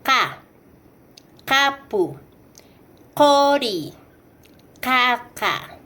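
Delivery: "ka", "Kapu", "Kori", "Kaka" with the K far back in the throat; "ka", "Kapu", "Kori", "Kaka" as qa, qapu, qori, qaqa; uvular Q. Shown as a demonstration. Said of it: "tenemos el fonema".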